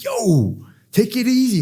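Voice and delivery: high-pitched, acted "whoa, settle down"; urgent calming. Teenager telling upset friend to settle, urgent calming. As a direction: jump up in pitch to check a friend.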